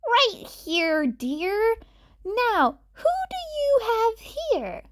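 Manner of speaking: put-on high, old lady voice; elderly nurse.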